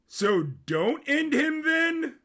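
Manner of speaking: a whiny prince stretching out his doubt; high slow Sisko voice. A narrator's reading. Narrator voicing Sisko asking his cousin whether to spare the boy, high and slow.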